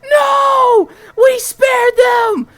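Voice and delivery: high, loud desperate screaming; screaming in despair. Retold or imitated. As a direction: scream at your friend to stop, voice cracking. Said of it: "I'm screaming".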